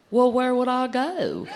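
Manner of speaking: drawn-out, puzzled Southern drawl; Southern accent. Acted. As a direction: answer slowly in an earnest Southern accent. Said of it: "it's just that accent".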